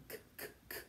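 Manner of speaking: k, k, k as bare voiceless bursts; hard C. Shown as a demonstration. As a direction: tap out k, k, k with no vowel. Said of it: "H makes that C hard".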